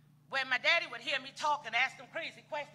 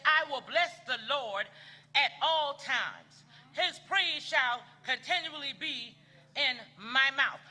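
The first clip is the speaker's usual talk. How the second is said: slow, solemn reading of the psalm; scripture recitation; 6 dB louder, slower than usual. Acted. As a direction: lift the verse up like a proclamation. Preacher quoting psalm slowly.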